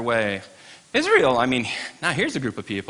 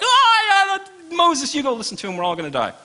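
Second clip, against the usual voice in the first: panicked crowd; 3 dB louder than usual. Acted. Frightened Israelites beg Moses.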